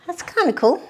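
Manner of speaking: high, loud burst of delight; pleased. Real, not acted. Painter surprised by how well the stroke came out, delighted. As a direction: pop the line out with sudden pleasure.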